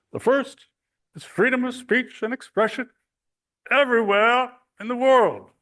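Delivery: put-on high, loud old-fashioned orator voice; Roosevelt impression. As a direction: declaim it like FDR on the radio. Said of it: "I'll do the voice 'cause, uh, Niels asked me to".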